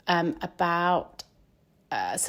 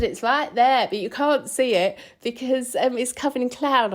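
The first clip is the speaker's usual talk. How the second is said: a bad, half-hearted Scottish accent, a bit higher; attempted Scottish accent; 5 semitones higher than usual. Petrol lady pointing out Ben Nevis, attempted Scottish accent. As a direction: has a go at the Scottish accent anyway. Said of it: "I can't do a Scottish accent".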